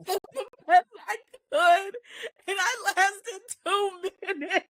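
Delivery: high, squeaky, near-tears retelling; laughing lament. Retold or imitated. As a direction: retell his lament while cracking up. Retells his lament, cracking up.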